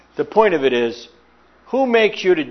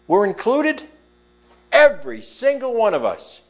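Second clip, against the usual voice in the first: raised, fervent preaching with slowed emphasis; 6 semitones higher than usual. Acted. Preacher reminding the congregation of their past, fervently pitched up. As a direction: lift it up and press each phrase home.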